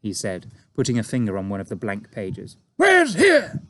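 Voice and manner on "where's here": high, loud alarmed cry; army chief crying out in alarm, loud; cried. A narrator's reading. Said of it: "cried the head of the army".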